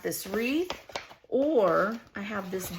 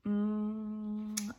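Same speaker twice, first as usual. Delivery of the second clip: ordinary talk, nothing marked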